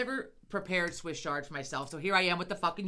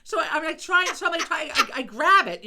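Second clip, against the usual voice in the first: flustered retelling; 8 semitones higher, 9 dB louder than usual. Retold or imitated. Scrambling to save fallen chicken.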